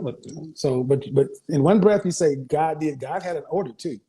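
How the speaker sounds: usual speaking voice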